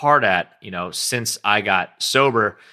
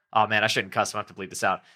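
usual speaking voice

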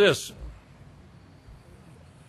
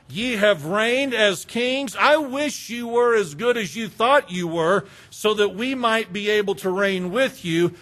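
ordinary talk

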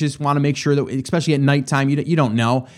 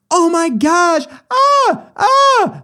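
high frightened scream, imitated; retold scream; 14 semitones higher, 4 dB louder, much slower than usual. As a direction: recreate the wife's terrified shriek of shock. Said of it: "like, screams".

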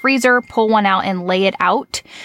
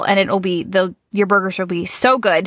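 usual speaking voice

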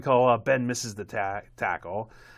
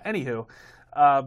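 ordinary talk

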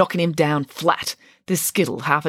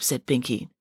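ordinary delivery

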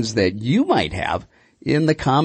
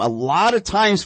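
normal talking voice

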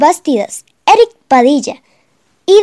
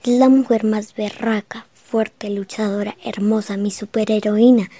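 ordinary delivery

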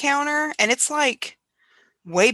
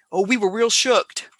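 put-on Pennsylvania accent. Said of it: "I love listening to the accents".

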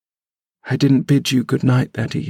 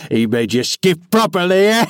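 put-on sly, taunting old man; mocking; 4 semitones higher than usual. A narrator's reading. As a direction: needle him with glee.

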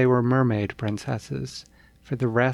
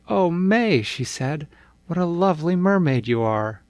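high, loud and quick with delight; 6 semitones higher, 5 dB louder than usual. Acted. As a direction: gush over your sister. Greta delighted greeting sister.

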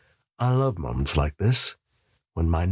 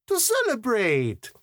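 much higher woman's voice, firm; Alicia's voice; 14 semitones higher than usual. A narrator's reading. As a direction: cut in brightly and take charge. Alicia links his arm.

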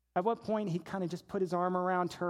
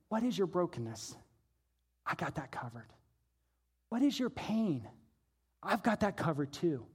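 soft, low, unhurried quote; hushed, tender promise; gentle reassurance; 4 semitones lower, 5 dB quieter than usual. Retold or imitated. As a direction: voice Jesus quietly comforting the broken. Jesus comforting the broken.

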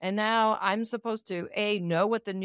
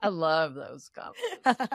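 laughing; 5 semitones lower, slower than usual. Real, not acted. Laughing through comment.

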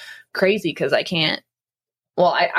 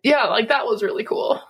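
choked up; 5 semitones higher than usual. Real, not acted. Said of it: "Sorry, I'm getting choked up".

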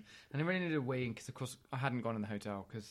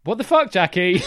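loud, higher incredulous outburst; shouted comic disbelief; mock outrage; 5 semitones higher, 17 dB louder than usual. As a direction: explode at the absent reviewer. Outraged reaction to the review.

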